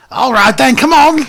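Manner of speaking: high, loud goblin calling out; a shrill goblin yelling at the party to come on; eager goblin. Acted. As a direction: wave them forward like an excited little goblin. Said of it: "this goblin runs back and goes".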